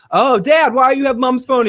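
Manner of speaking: put-on high, whiny teenage voice; lightly voiced teen son; mock teen son. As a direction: play the clueless teen son answering his dad.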